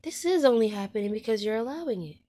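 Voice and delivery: loud, firm words to herself; a loud wake-up call to herself; firm self-talk. Retold or imitated. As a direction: tell yourself off out loud. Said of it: "and I said".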